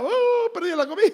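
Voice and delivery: high, whiny mock wail; crying child. Child losing the meal, hammed-up wail. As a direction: lament the lost food like a small child. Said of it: "mi esposa lloraba".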